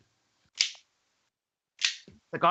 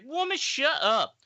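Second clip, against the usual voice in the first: high, slow mock command; hammed-up order to pipe down; mock snap; 12 semitones higher, slower than usual. Friend quoting Derek telling the woman off, high mock snap. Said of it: "He's like".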